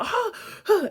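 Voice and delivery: high, acted gasp of panic; panicked gasp. Reenacting her foot slipping off the ladder, panicked gasp. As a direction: gasp as if freaking out. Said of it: "freaking out".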